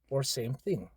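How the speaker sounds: usual speaking voice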